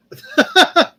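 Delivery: a high, loud burst of laughter; sudden high laugh. Real, not acted. Laughs at the gray kitty.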